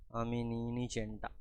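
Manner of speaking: very soft, slow "আমি নিইনি চেনটা"; low-voiced denial. The boy denies taking the chain, in a low voice. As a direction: murmur it toward the floor. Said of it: "ধীর গলায় বলছে".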